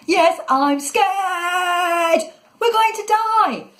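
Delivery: very high, drawn-out scared wail; terrified high-pitched scream; panicked yell. Acted. Falling boy yells in panic.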